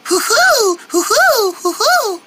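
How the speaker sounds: high owl hooting. Pretend. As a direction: hoot like an owl.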